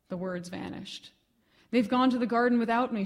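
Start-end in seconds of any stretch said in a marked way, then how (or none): 1.7-3.0 louder, an anguished cry. Acted. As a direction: cry it out in grief. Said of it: "she cried".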